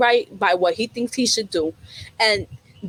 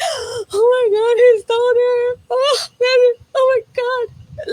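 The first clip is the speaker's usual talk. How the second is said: high, drawn-out tearful wail; mock crying; 13 semitones higher, 5 dB louder, slower than usual. Retelling her tears over the movie character, high mock crying. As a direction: wail like you're sobbing at a sad movie. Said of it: "he made me cry".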